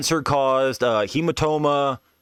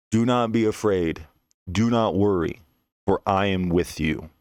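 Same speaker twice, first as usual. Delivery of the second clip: calm, assured voice; 4 semitones lower than usual. Acted. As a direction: speak each phrase evenly and settle lower. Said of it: "I hear a calm, assured, steadfast voice".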